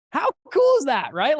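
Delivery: high, loud burst of delight; really excited exclamation to the audience. Real, not acted. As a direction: share the win, thrilled. Excited about fund data.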